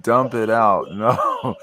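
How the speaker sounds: ordinary talk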